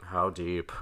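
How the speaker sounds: low and soft, echoing a co-host; deep voice. Pretend. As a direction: play along in a deep voice. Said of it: "use your deep voice".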